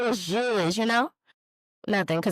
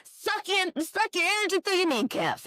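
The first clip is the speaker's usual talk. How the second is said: higher, comic alarmed inner voice; mock horror; 11 semitones higher than usual. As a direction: play the dread in your head for laughs. Inner voice, horrified thought.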